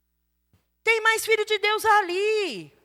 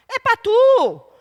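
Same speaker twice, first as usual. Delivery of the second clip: pra tu dragged slowly and louder; slow emphatic; 4 semitones higher, 7 dB louder, much slower than usual. Real, not acted. Preacher pointing at the leader, slow and emphatic. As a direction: lean hard on pra tu. Boss gets the complaints.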